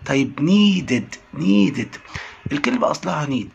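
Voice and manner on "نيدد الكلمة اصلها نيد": ordinary talk, nothing marked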